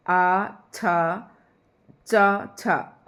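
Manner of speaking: आ छा and चा छा said slowly as separate syllables; sounding out syllables. Shown as a demonstration. Teacher sounding out आच्छादन syllable by syllable for students. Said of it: "इस तरीके से आप दो बार इसे लिख कर देख लें".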